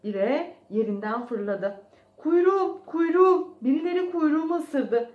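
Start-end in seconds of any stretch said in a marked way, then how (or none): 2.2-5.1 high, loud cries of pain; pained yelling. A narrator's reading. Mouse whose tail was just bitten, yelling in pain. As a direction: yell out as if badly hurt. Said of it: "diyerek bağırıyordu".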